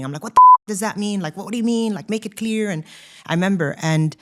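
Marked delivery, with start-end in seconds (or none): 0.2-2.7 louder, higher exasperated burst of confusion; frustrated outburst. Retold or imitated. Remembering her coach's vague advice, a loud frustrated outburst. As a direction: blurt it out, fed up and lost. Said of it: "it was so frustrating".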